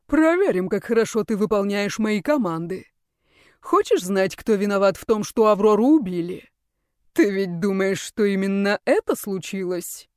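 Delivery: sly commanding ruler voice; scheming villain. Acted. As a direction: tease him like a puppet master. Ruler tests his creation.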